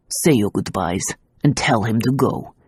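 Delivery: gruff. A narrator's reading. Gendarme ordering Tazia, gruff and low. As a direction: growl the order down at her. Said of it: "he told Tazia gruffly".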